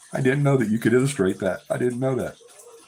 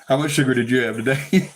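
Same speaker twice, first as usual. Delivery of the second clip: normal talking voice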